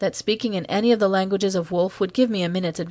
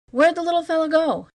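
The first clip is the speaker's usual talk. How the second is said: high rattled demand; 10 semitones higher than usual. Acted. Young guard demanding an answer after the scare, high rattled demand. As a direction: demand it, shaken and cursing. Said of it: "demanded profanely".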